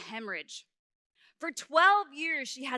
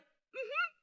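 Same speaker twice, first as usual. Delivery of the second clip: tiny high hum of agreement; innocent fib; 4 dB quieter than usual. Retold or imitated. Child sticking to her story with mom, high innocent hum. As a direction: hum it high like a fibbing kid.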